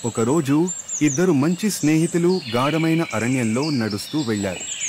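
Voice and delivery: plain reading voice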